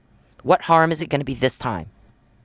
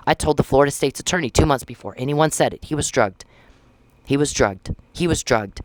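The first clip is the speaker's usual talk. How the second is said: ordinary delivery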